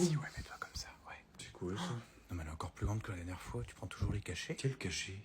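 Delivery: hushed unvoiced whisper. Kids eavesdropping at the bedroom door, whispering. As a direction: whisper so the parents can't hear.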